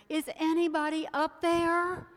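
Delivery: high, loud call upward; shouted call. Acted. Man hanging from a cliff calling for help, loud high shout. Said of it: "And so he shouted".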